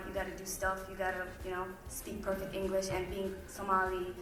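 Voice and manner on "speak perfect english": ordinary talk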